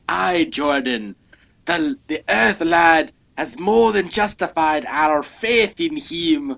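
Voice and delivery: a light Scottish lilt on the comic line; half-hearted Scottish read of the line; Scottish accent. Shown as a demonstration. Reading the alien's line to his co-host in mild Scottish. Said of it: "if it was spelled out more like".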